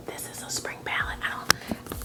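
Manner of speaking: hushed, voiceless aside; furtive whisper. Real, not acted. Vlogger sneaking in a palette for viewers, furtive whisper. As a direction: keep it under your breath.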